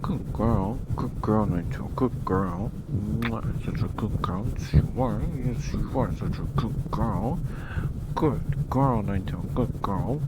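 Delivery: put-on loud, sing-song good-girl voice; gushing pet praise. Praising Ninetale like a pet, loud gushing pet talk. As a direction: hype her up like a proud dog owner.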